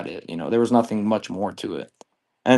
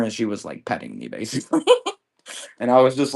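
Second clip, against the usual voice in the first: ordinary talk, nothing marked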